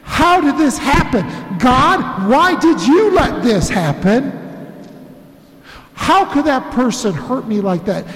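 hurt believer railing that it's not fair; slow, pleading indignation rising to anger; angry protest. Pretend. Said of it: "anger is gonna be one of those things you feel".

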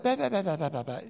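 foolish prattle. Acted. Preacher mimicking stupid talk to the congregation, foolish prattle. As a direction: babble it off as empty noise. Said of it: "foolish prattle".

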